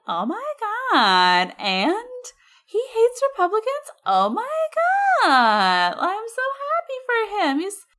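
high, loud squealing delight; gushing fan. Retold or imitated. Gossiping public gushing over Jesse James, squealing high. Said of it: "So it wasn't even just like".

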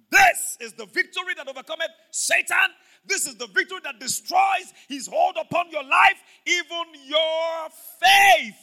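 loud, rising preacher's cry; preaching at full force. Acted. Pastor thundering at his church, full-force preaching. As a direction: drive the victory home to the congregation.

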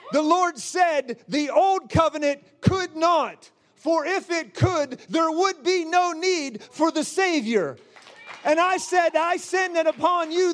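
high, sustained, ringing declaration; impassioned preaching. Real, not acted. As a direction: proclaim it to the whole church in long driving phrases. Preaches at full force.

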